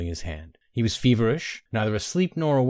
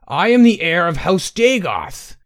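slow, loud, much higher declaration; near scream; 8 semitones higher, 8 dB louder, slower than usual. A narrator's reading. Wild-eyed Tay declaring his lineage to Bainara, almost screaming. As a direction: proclaim it wildly, almost screaming. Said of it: "almost screaming".

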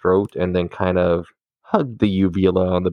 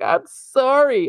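apologetic; slower than usual. Retold or imitated. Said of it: "I was like".

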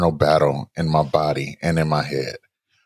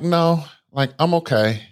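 raised-pitch refusal; 7 semitones higher than usual. Retold or imitated. Retold self refusing the bus.

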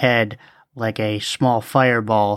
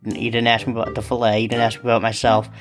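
fast indignant rant; 4 semitones lower, faster than usual. Acted. Rapid indignant complaint.

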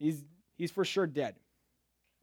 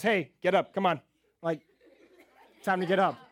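loud, higher mock wake-up call; mock rousing call; 3 semitones higher, 6 dB louder than usual. Someone walks up at a funeral, mock rousing call. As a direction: rouse someone casually, louder. Imagined person rousing the dead.

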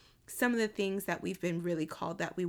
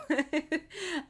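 happy laugh; 7 semitones higher than usual. Real, not acted.

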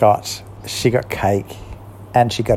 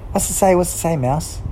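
lightly higher, eager Kat; 9 semitones higher than usual. A narrator's reading. Kat urging Mouse to read, a bit higher. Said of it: "Mouse started reading".

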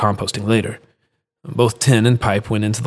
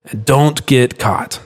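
slow warning; 2 semitones higher, much slower than usual. Acted. Carlos warning Julian.

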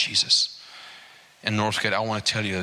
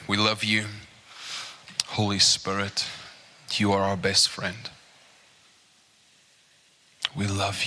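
prayer. Acted. Preacher leading the church in prayer, earnest. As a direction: pray over the congregation, unhurried.

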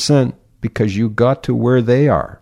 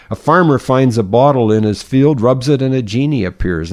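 usual speaking voice

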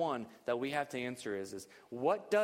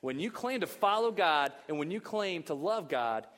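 preaching at full force; 4 semitones higher, 5 dB louder than usual. Acted.

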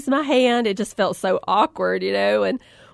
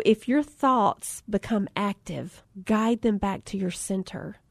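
zen instructor; 6 dB quieter than usual. Retold or imitated. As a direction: float it out like a calm yoga guide. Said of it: "So she said".

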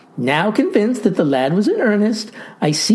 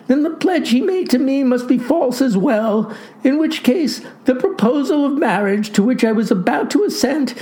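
put-on high-pitched, indignant girl; crying out; 6 semitones higher than usual. A narrator's reading. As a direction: declare it in a raised girlish voice. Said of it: "cried the girl".

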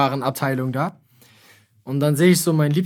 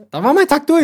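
mock exasperation; 11 semitones higher, 4 dB louder than usual. Real, not acted. Dramatic frustration.

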